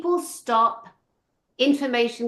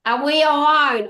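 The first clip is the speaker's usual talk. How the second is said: exasperated mock quote; 4 semitones higher, 7 dB louder than usual. Fed-up viewer parrots the presenters' question, exasperated mockery. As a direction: throw their tired question back, loudly mocking. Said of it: "if they dare one more time say".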